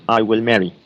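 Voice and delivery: ordinary delivery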